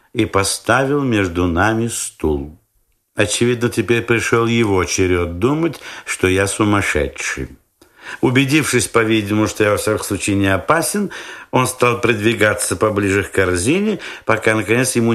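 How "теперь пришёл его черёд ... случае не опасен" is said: ordinary delivery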